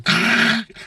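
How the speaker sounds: harsh, high rattling mouth noise; crunching gear noise by mouth; imitated transmission grinding. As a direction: imitate bolts rattling in a blender. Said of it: "it sounded like I dropped a whole bunch of screws and bolts".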